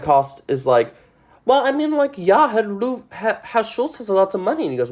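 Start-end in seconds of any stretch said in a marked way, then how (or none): 1.4-4.6 high-pitched put-on voice for a gossiping woman; high, mock character quote; acted stage character. Voices Fräulein Kost in story.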